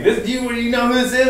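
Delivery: high, fast, loud mock scolding; hammed-up indignant yell; imitated yelling mom. Mom calling from work, imitated yelling. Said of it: "yelling at me".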